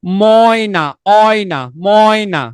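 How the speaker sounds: ময়না drawn out slowly, with the অয় stretched; ময়না spoken slowly to show the অয় glide; slow syllable demo. Shown as a demonstration. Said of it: "ময়না শব্দের মধ্যে অয় উচ্চারিত হয়েছে কিনা".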